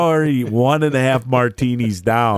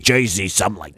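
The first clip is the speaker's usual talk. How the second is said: put-on Jersey accent; 3 semitones lower, much faster than usual. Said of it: "he talks like this".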